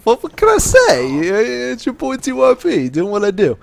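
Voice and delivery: high, slow comic swagger; playful squeaky self-promotion; put-on catchphrase voice. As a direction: ham up a silly boast in falsetto.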